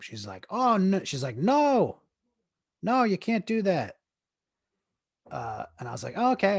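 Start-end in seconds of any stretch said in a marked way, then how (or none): 0.5-1.0 high, loud Caribbean lady imitation; Jamaican accent. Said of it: "lady with Jamaican slash Caribbean accent". 1.4-4.0 much higher, loud Caribbean lady imitation; mock-scandalised refusal in a Jamaican accent, pitched way up. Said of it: "lady with Jamaican slash Caribbean accent". 6.2-6.6 high, loud acted reply; backing-off reply. Acting out his reply to refusal.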